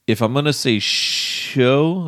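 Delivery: "show" drawn out slowly for emphasis; slow line with a long, emphatic "show"; stress on show. Shown as a demonstration. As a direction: stretch and lean on the word "show". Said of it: "emphasis on the word show".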